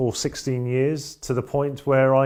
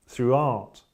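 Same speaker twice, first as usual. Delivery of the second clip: ordinary delivery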